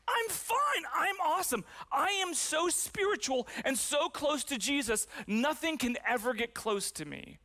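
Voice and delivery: high, bright-pitched insistence that all is well; fake cheerful denial. Teen brushing off questions about divorce, falsely upbeat. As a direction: chirp it out as if nothing could touch you. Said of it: "I'd be like".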